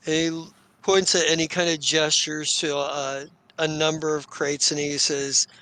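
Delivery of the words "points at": ordinary talk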